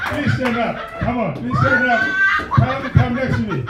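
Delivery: usual speaking voice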